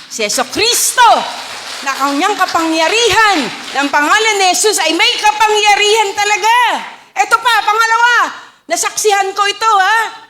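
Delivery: high, loud preaching about Jesus; preaching at full force. Real, not acted. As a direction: proclaim it to the whole congregation.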